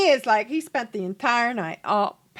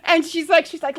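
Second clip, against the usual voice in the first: ordinary delivery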